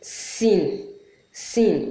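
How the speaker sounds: sin hissed through closed front teeth; sin with teeth together. Shown as a demonstration. Teacher showing the sin sound with teeth closed. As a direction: bite your teeth and hiss sin. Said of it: "this you must produce while biting your two teeth".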